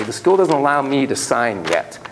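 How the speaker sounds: usual speaking voice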